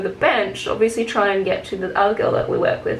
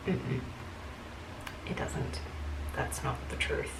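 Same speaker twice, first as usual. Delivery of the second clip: barely audible, voiceless retort; hushed denial; 9 semitones lower, 17 dB quieter than usual. Retold or imitated. Contradicting a claim in fake lawyer land, hushed. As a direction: murmur it almost under the breath. Acted denial in retold argument.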